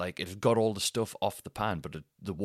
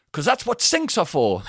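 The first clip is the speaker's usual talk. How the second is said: loud, high, indignant shout; retold parting shot; 9 semitones higher, 11 dB louder than usual. Angry bloke's parting words to upstairs, loud indignant shout. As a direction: yell it up as the last word. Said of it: "his parting words".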